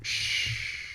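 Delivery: long soft shush; hushing shh. Real, not acted. Trader hushing himself to keep a tip secret, hushing. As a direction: draw out a quiet shh.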